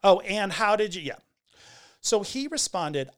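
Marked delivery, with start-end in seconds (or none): 0.0-1.0 loud, raised, challenging imitation; exaggerated pointed query; mock animosity. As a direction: throw it out as an accusing question. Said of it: "there's probably some animosity in there".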